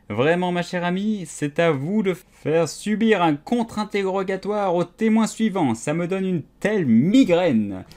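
ordinary delivery, nothing marked

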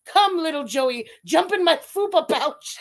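loud, hammed-up summons; mock kangaroo mother. Mother kangaroo calling her joey to the pouch, mock-dramatic call.

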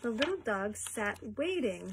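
usual speaking voice

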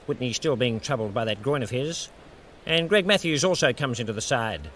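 calm, measured broadcast voice; a dry, understated cricket commentator opening the match; TV cricket commentary. Acted. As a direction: welcome the viewers on air.

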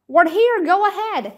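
shouting. A narrator's reading. Jomo shouts into the radio receiver. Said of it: "he shouted".

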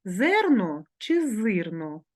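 зерно чи зирно said slowly, each vowel stressed to contrast е and и; slow spelling choice. Shown as a demonstration. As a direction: drag out зерно and зирно so both vowels are heard. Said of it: "Як же написати".

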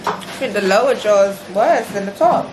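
muffled behind fingers; speaking through covered mouth; hand over mouth. Real, not acted.

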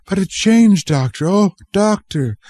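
put-on high, pleading little cry of a sick boy; pitiful cry. A narrator's reading. Boy pleading with the doctor, pitiful high cry. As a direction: beg the doctor, near tears. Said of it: "cried Charles pitifully".

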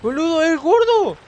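very high, loud alarmed yell; panicked shout. Real, not acted. Gamer spotting the enemy, yelling in panic. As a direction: scream the warning.

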